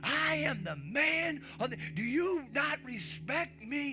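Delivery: domineering father. Retold or imitated. Father to his kids, a mock angry rant. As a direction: lord it over the kids as the man of the house. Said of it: "is not".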